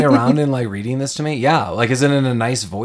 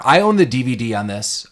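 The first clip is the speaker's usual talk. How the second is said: ordinary talk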